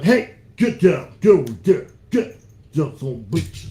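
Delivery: loud sharp commands to the dog; scolding the dog. Real, not acted. Owner scolds dog on the couch, loud and sharp. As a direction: snap at the dog to get down.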